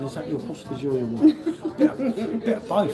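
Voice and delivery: talking through a laugh, pitch lifting; amused, laughter breaking into the words. Real, not acted. Vlogger joking with partner about a viewer's hostage question, laughing.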